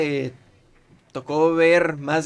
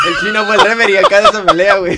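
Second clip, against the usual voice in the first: loud, higher, through laughter; laughing tease; 5 semitones higher, 13 dB louder, faster than usual. Real, not acted. Joking that Chino refereed David and Goliath, loud laughing tease. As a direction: crack up while ribbing a friend.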